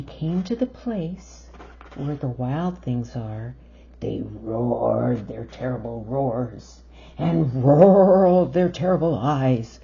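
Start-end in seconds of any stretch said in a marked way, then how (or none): 4.0-9.8 loud, growling storybook read; lower, then much louder monster-style telling; roaring build. A narrator's reading. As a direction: roar the words out like monsters. Wild things roaring, read aloud.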